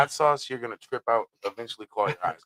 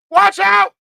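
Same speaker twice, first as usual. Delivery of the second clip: high, loud yell of warning; a mock shouted "Watch out!"; 11 dB louder than usual. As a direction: call out sharply to stop someone. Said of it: "A person can say".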